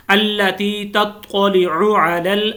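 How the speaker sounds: slow chanted tajweed reading; measured Quranic chant; slow recitation. Shown as a demonstration. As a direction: recite the verse slowly and evenly. Recites next verse.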